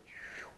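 whispered whoosh; whoosh sound. Retold or imitated. Preacher imitating the fire wiping them out, whispered whoosh. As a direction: breathe out a quick whoosh.

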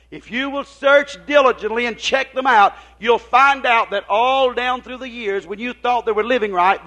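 loud, driving sermon delivery; full-force preaching. Acted. Preacher exhorting the church to get into this thing, full-force preaching. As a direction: preach it hard, high and loud.

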